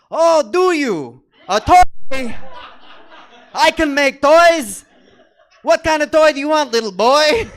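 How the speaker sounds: very loud, high, slow Yiddish Santa; booming, hammed-up Yiddish Santa. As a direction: belt it out as a Yiddish-sounding Santa. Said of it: "That is a decidedly Yiddish Santa".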